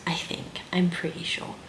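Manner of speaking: hushed, unsure whisper to herself; whispered aside. Real, not acted. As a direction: breathe it as a doubtful afterthought.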